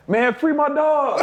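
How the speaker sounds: high, slow pleading call; teammates pleading. Retold or imitated. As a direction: drawl it out as a chant. Teammates chanting for him.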